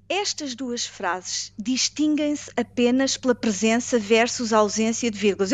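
usual speaking voice

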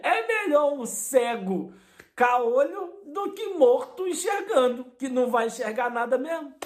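high, incredulous outburst; exasperated exclamation. Retold or imitated. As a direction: raise the voice in shocked disbelief. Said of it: "Eu falei". (exclamação alta e incrédula)

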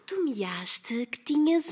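slow, hushed whisper. Acted. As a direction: whisper the secret. Secret plot, servant hushed her.

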